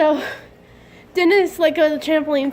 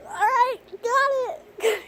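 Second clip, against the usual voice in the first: much higher, strained and tight; 10 semitones higher, 3 dB quieter, much slower than usual. Retold or imitated. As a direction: squeak it out under the weight. Strained under the trampoline.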